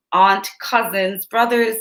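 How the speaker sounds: ordinary delivery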